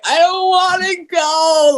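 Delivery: high, drawn-out mock whine; whiny dread. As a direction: dread going back to the ward. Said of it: "I'm like".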